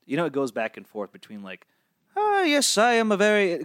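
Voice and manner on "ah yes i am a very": much higher, grand pompous put-on voice; Crowley's voice. Shown as a demonstration. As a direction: show how you read the wordy character aloud. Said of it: "How do you read Crowley's voice?".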